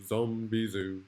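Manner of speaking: plain reading voice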